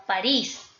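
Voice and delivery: "París" with the force on the final "rís"; stress on last syllable. Shown as a demonstration. Teacher giving students an example of an aguda word, final-syllable stress. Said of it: "fuerza vocálica caiga en la última sílaba".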